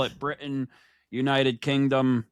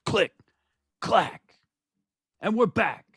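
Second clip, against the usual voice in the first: slow, punched ring-announcer intro with big pauses; show-opening announcer; 7 semitones higher, slower than usual. Acted. Host introduces the show and tag team partner, announcer style. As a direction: announce each name like a main event.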